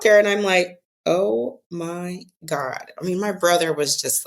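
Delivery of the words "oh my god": very slow, drawn-out amazement; retold awestruck disbelief, word by word; stunned awe. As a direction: stretch each word, overwhelmed by the sight. Acted stunned reaction to huge crowd.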